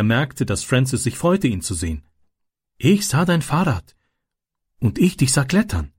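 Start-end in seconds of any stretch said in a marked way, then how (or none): 2.8-5.9 put-on higher, shy boy's voice; higher, timid child voice in broken German; Ram, shy. A narrator's reading. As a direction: say it bashfully to a friend. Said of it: "sagte Ram schüchtern".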